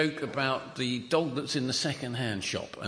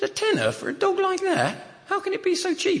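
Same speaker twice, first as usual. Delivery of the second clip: much higher, laughing disbelief at tenner; incredulous disbelief; 4 dB louder than usual. Retold or imitated. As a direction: squeak out tenner as if it can't be true. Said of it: "He says".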